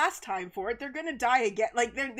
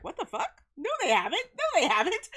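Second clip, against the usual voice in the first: high, rising stunned denial; high, loud incredulous no; shocked disbelief; 9 semitones higher, 4 dB louder than usual. Retold or imitated. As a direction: refuse to believe it. Her disbelief at the news.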